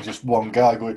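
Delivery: ordinary delivery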